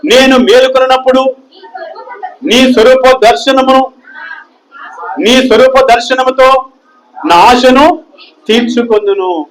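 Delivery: measured pulpit recitation of scripture; solemn scripture reading. Acted. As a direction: lift each phrase of the psalm slowly. Pastor reads psalm verse aloud.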